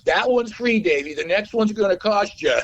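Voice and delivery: high, eager schmoozer voice; gushing record guy. Retold or imitated. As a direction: gush at him like a slick industry guy. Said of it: "He goes".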